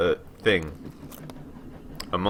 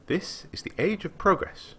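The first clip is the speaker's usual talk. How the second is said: put-on higher, eager young woman's voice; a young scholar boasting to a traveller, lightly voiced; lightly voiced eager girl; 13 semitones higher than usual. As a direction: read her up high and keen, lightly. Girl's voice again.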